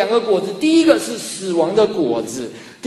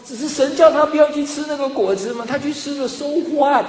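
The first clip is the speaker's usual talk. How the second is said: dismissive objection; 7 semitones higher than usual. Retold or imitated. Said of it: "你可能会想说".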